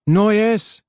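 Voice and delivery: high, loud call for her. A narrator's reading. Harlan calling for Noyes, loud and high. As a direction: shout her name down the corridor.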